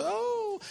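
high, soft mock wail; mock crying. Preacher showing an ugly cry in prayer, mock wail. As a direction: feign a drawn-out sobbing moan. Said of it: "that, that ugly cry".

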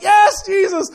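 shouting praise, pitched high; joyful shout. Retold or imitated. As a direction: call it out in worship. Pastor worshiping at stoplight.

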